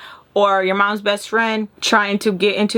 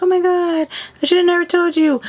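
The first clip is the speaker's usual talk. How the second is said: put-on whiny kid; 10 semitones higher than usual. Kid regretting telling mom, high panicked whine. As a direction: squeal it like a kid who blabbed. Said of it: "just being a kid and being like".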